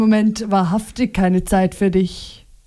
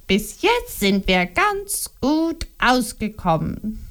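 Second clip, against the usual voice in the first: Liliana retorting; 3 dB quieter than usual. A narrator's reading. Wife retorting to her husband, sharp. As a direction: fire back at him. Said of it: "gab Liliana zurück".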